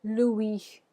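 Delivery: "Louis" said as loo-EE, no final S; French "Louis", ending on "ee" with a silent S; French pronunciation. Shown as a demonstration. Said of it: "repeat after me".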